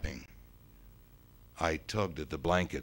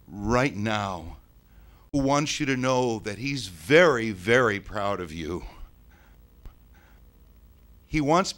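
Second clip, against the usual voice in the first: halting, emphatic medium building higher and louder; channelling medium; 6 semitones higher, 10 dB louder than usual. Acted. Ghost relays dead father's pride to the boy, channelling medium. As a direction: deliver a message from beyond, swelling as it goes.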